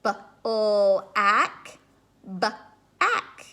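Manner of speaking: put-on segmenting for children; stretched sound-out. Shown as a demonstration. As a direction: stretch the sounds apart in "B-o-ack" then "b-ack". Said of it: "It's the O sound".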